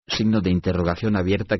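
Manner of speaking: usual speaking voice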